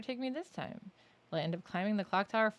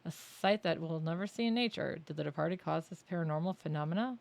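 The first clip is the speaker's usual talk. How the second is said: normal talking voice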